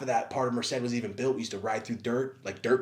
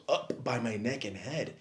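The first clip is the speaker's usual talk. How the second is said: ordinary talk, nothing marked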